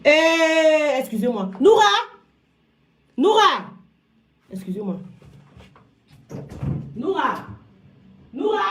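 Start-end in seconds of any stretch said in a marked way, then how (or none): 1.6-8.6 appel au loin. Real, not acted.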